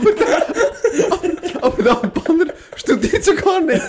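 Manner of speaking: laughing. Real, not acted. Teasing friend with laughter.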